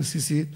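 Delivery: סיסית with "s" sounds; rejected pronunciation. Shown as a demonstration. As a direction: say סיסית with plain "s" instead of "ts". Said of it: "לא אומרים". (סיסית בסמ"ך ולא בצדי)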